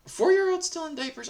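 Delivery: high, shocked question; incredulous. Pretend. Imagined incredulous listener.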